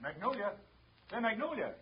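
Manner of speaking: high, eager call; excited calling. Acted. Husband rushes in after winning, calling excitedly. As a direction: burst in full of news. Husband bursts in late night.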